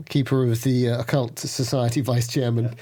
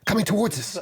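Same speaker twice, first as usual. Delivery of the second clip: higher, urgent alarmed warning; 8 semitones higher than usual. Acted. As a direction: point out the danger in a frightened rush. Warning friend of approaching shadow.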